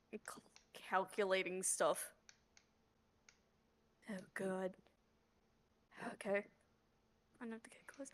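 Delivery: whispered under the breath; whispering. Real, not acted. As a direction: quietly whisper it to yourself. Player thinking aloud quietly.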